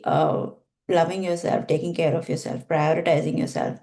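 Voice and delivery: ordinary delivery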